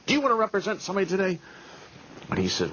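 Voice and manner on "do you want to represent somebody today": high, rushed imitation of an agitated judge; hammed-up panicky plea; mock frantic. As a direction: rattle it out way up high.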